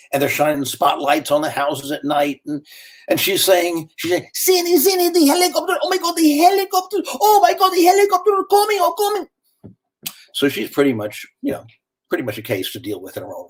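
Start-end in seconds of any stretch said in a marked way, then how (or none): 4.4-9.3 put-on high, panicked older woman with a Cuban accent; frantic Cuban mother. Cuban mother-in-law panicking at the helicopters, high and frantic. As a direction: shriek it like a terrified old lady hearing helicopters.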